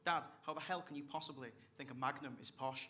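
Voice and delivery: normal talking voice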